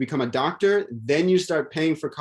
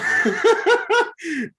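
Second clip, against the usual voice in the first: high, loud real laugh; big high-pitched cackle of amusement; 4 dB louder than usual. Real, not acted. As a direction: burst out laughing mid-point. Laughs after making the point.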